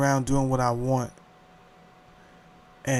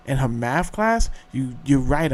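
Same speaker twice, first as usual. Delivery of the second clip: normal talking voice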